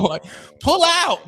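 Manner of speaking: high, slow, drawn-out disbelief; exasperated. Real, not acted. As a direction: stretch it out, exasperated. Exasperated advice, repeated louder.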